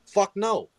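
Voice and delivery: high, loud mock-angry Fuck no; defiant outburst. Dad fires back at appalled school staff, loud and high. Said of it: "dad yells at school staff".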